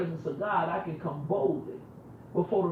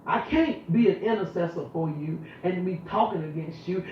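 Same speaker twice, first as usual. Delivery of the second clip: normal talking voice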